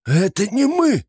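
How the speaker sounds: high, loud frightened shout; panicked yell. A narrator's reading. Flappy yells to the monster, blaming the lord, panicked. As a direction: blame someone else in terror. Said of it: "завопил Флаппи".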